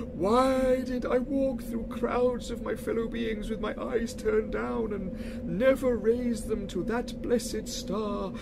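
high, slow, loud mournful spectre; lamenting ghost. Acted. Marley's ghost laments his life to Scrooge, slow and mournful. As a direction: grieve over every wasted year.

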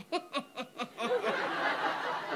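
imitated sneaky classroom cackle; kids' cackle. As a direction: cackle like scheming schoolkids. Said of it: "the cackle of all the kids".

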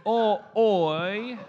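laboured Aussie, one loud word at a time; Australian character. Acted. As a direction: think hard over every syllable in fake Australian. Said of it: "I will play ... an Australian".

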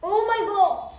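high, loud amazed cry; surprised. Real, not acted. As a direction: gasp it out in real surprise. Surprised by soda in candy.

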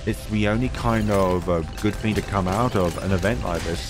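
ordinary talk, nothing marked

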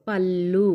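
"పళ్ళు" with the double L held long; doubled L sound. Shown as a demonstration. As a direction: lean on the joined L in "పళ్ళు". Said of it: "లా అని పలుకుతాం".

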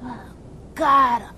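usual speaking voice

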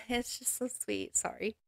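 moved almost to tears, holding it together; teary-eyed. Real, not acted. Host moved by a quote, softly teary. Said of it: "A little teary-eyed".

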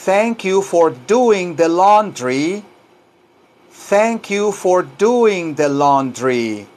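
slow, careful enunciation for learners; drawn-out language-lesson delivery; slow model sentence. Shown as a demonstration. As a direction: give each word room to be copied.

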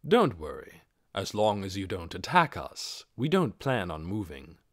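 calm armored warrior thinking aloud; Ainz's cool voice. Acted. Ainz identifies the summoned goblins, low and composed.

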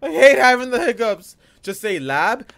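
ordinary talk, nothing marked

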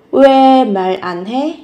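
slow, each syllable spelled out; drawn out for learners to copy. Shown as a demonstration. Teacher slowing the phrase down for students, slow and separated. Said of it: "Mal có nghĩa là cái lời nói".

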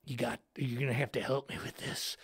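a hint of a quiet low worker; low mumbling guy. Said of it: "He's like".

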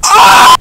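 very high loud shriek; scream. Real, not acted. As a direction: let out a sudden shriek. Reacting to a clip.

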